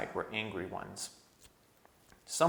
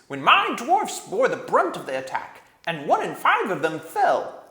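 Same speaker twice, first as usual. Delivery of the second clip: much higher, loud furious dwarf; angry dwarf; 10 semitones higher, 11 dB louder than usual. A narrator's reading. Nickabrick snapping at the king, high and furious. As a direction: spit it out with bitter rage. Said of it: "came the angry voice".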